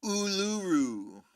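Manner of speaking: drawn-out, slow place name; playful savouring. Pretend. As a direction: stretch it out for fun.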